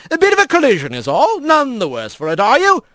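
high, lilting jovial skipper; genial, grinning reassurance; grinning otter mayor. A narrator's reading. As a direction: brush it off cheerily and briskly. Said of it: "said with a grin".

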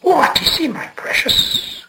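high then whispered creature voice; Gollum whisper. A narrator's reading. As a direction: hiss it to yourself like a cave creature. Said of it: "whispered Gollum".

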